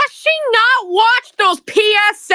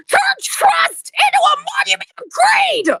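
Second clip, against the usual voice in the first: high furious shout; 10 semitones higher than usual. Real, not acted. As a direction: scream it at her. Outburst at the bully.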